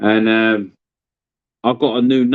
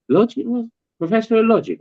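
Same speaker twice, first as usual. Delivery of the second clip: baffled; 11 semitones higher than usual. Retold or imitated. Mate baffled by the word.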